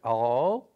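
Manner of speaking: "al" held out with a real L; unwanted final L. Shown as a demonstration. Teacher showing what Brazilians avoid, "al" with a full L. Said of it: "we don't pronounce like".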